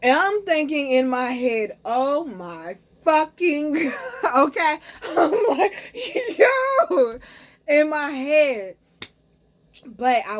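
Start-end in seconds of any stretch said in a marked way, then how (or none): 1.8-4.4 slow, high, laughing half-cry of shock; laughing panic. Retold or imitated. To viewers, reliving the crash scare, laughing and breaking. Panicked thought as car veers. 6.4-7.2 high, loud, laughing cry; laughing yelp. Retold or imitated. To viewers, reliving the moment, a high laughing yelp. Panicked thought as car veers.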